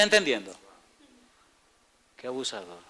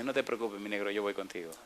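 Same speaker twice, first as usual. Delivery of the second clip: tender God; 5 semitones lower, 10 dB quieter than usual. Acted. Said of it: "Pero el Señor dice".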